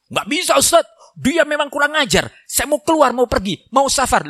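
mock a fed-up husband protesting to the preacher; complaining husband. Angry husband voiced complaining.

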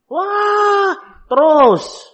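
high, loud mock exclamation; mock shout. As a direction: blurt it out high like a startled parent. Parent reacting to crying child. (teriakan pura-pura, tinggi dan keras)

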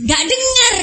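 mock shout. Can't hear over the waves.

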